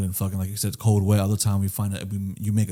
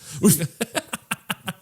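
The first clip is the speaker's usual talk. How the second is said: laugh; 6 semitones higher, 4 dB quieter than usual. Real, not acted. Laughs at Bench.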